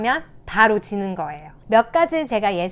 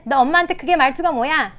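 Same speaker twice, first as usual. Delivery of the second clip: higher, louder mock scolding; scolding mother; 4 semitones higher, 5 dB louder than usual. Shown as a demonstration. Mother to an angry child, acted scolding. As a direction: act a cross mom telling off. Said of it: "아이가 화가 났을 때는".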